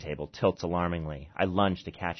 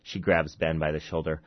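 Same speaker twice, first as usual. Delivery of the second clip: usual speaking voice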